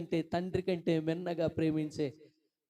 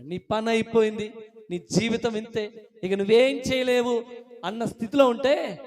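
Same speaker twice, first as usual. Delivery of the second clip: raised, emphatic preacher's voice; impassioned preaching; 5 semitones higher, 7 dB louder than usual. Real, not acted. Preacher voices "you are finished" to listeners, impassioned preaching. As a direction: hammer out each hopeless verdict.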